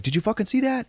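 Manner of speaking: high-pitched acted alarm; mock scared. Wife asks husband about the beast, mock scared. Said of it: "she's, like, scared shitless".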